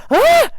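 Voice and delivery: shrill high shriek; scream of fright. Retold or imitated. She shrieks as the spider stares at her.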